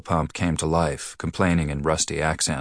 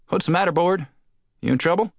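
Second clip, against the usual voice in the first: much higher, friendly call across street; a cheerful friend calling over; higher townsman voice; 12 semitones higher, faster than usual. Acted. Sandy-haired man calls across.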